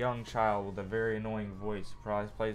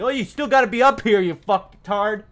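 high, loud sneering voice for the game; mock game voice; 11 semitones higher, 13 dB louder than usual. Gamer mocking what the game should say, sneering. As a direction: mimic the game scornfully.

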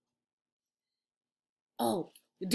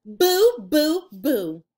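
1 repeated boo; 3 semitones higher, 7 dB louder, much slower than usual. A narrator's reading. Repeating monster scare.

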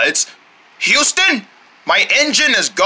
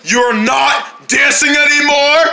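drawn-out shout; 7 semitones higher than usual. Acted. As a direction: stretch it into a shout. Repeated again, drawn out.

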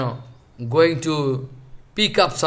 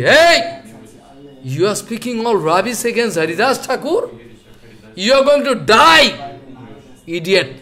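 angry; 3 semitones higher than usual. Acted. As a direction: shout a threat like an outraged assembly member. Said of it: "they become very angry".

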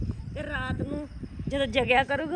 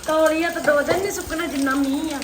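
loud complaining; 3 semitones higher, 7 dB louder than usual. Real, not acted. Complaining loudly about rain.

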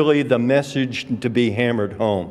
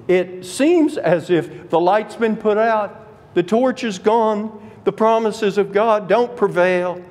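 dramatic lament; 9 semitones higher than usual. Acted.

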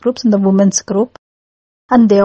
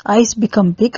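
ordinary delivery

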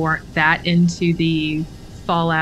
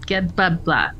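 usual speaking voice

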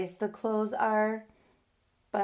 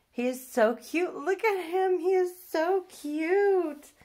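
cooing; 10 semitones higher, 5 dB louder than usual. Real, not acted. Cooing at doll again.

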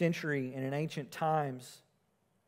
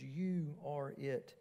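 soft, slow, drawn-out You are it; You and are stretched out, hushed; quiet, stating fact; 3 semitones lower, 9 dB quieter, much slower than usual. Shown as a demonstration. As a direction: say You are it softly and slowly, as plain fact. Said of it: "the word are, there stresses being".

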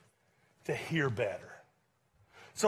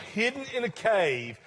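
ordinary talk

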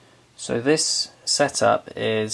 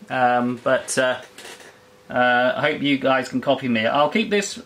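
on-air radio voice; 2 semitones higher than usual. Real, not acted. Live on-air radio contact.

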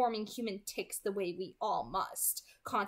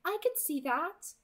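put-on higher, altered girlish voice; Alice's voice; 7 semitones higher than usual. Alice fibbing to Jasper to soothe him, higher voice. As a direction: reassure with a small lie. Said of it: "Alice murmured to him".

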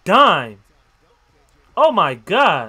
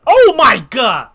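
excited exclamation; 3 semitones higher, 10 dB louder, slower than usual. Real, not acted. Excited by a play.

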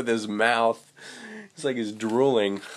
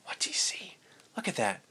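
secretive whisper; 4 semitones higher, 8 dB quieter, faster than usual. Real, not acted. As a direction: lean in and whisper. Peeking with the fish.

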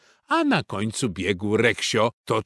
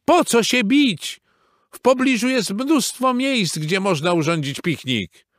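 high, loud pleading; urgent plea; 11 semitones higher, 4 dB louder than usual. A narrator's reading. Reksio trying to stop the fight, pleading. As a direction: reason with them at high pitch. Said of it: "próbował powstrzymać je Reksio".

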